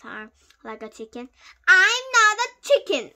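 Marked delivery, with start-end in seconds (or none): 1.7-3.1 loud, high indignant yell. A narrator's reading. Boy answering the bully's clucking, yelling. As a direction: yell back at the taunt. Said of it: "I yelled".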